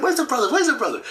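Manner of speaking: high, quick repeated asking; the elder asking for his brother, high and quick; eager question. Retold or imitated. Apostle asks eagerly.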